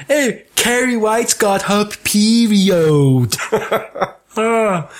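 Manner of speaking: high, slow, hammed-up public announcement; hammed-up cinema-wide announcement; mock announcement. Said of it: "shouting it for the cinema to go".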